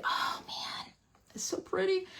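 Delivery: hushed wonder; awed whisper. Real, not acted. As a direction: lose your words in awe. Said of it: "I can't even talk right now".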